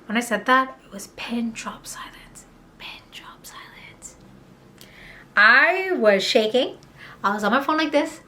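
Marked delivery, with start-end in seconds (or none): 0.9-4.2 hushed breathless whisper. Retold or imitated. Describing the silence after her accusation, whispered. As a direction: whisper it like the room went dead.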